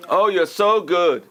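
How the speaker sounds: slow, drawn-out sarcastic praise. Shown as a demonstration. Teacher demonstrating sarcasm to the class, slow and mocking. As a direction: say it dripping with sarcasm.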